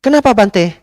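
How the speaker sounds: high, loud surprised "why"; imitating the lady asking why; pushy lady. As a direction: demand a reason in a high voice. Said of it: "Bu, saya nggak bisa".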